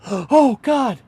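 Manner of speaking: high astonished hello; surprised greeting. Retold or imitated. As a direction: caught off guard, then thrilled.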